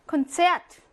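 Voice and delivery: 콘체트 with the stress on 체트; second-syllable stress. Shown as a demonstration. Teacher reading a loanword, 콘체트 stressed on 체트. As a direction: pass over 콘 and punch 체트. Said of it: "외래어 역시나 이 강세고요".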